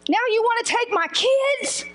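high-pitched, outraged challenge; acted-out indignant outburst. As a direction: flare up in disbelief. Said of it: "I wanted to say".

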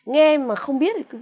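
ordinary delivery

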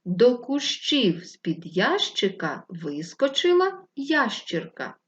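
slow, careful tongue-twister reading; tongue twister read slowly. Shown as a demonstration. Teacher to class, slowly reading a tongue twister. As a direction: read each word of the twister slowly and clearly. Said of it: "читаємо скоромовку".

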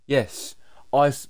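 normal talking voice

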